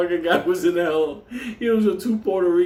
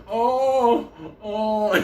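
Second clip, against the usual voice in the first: patients' pained moans; 6 semitones higher than usual. Retold or imitated. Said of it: "he's hearing motherfuckers".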